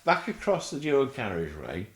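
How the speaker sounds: ordinary talk, nothing marked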